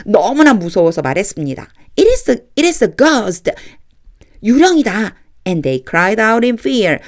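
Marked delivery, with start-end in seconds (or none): 2.0-5.1 frightened cry. Acted. Disciples cry out in terror.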